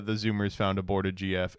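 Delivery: normal talking voice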